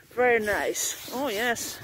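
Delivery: "Very nice" slow and drawn out, savouring it; drawn-out appreciation. Real, not acted. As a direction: stretch out "Very nice" like you're savouring it. Admiring tent, repeated slowly.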